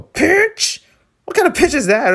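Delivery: high-pitched mock disbelief; incredulous. Real, not acted. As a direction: scoff at the word. Mocking the word pitch.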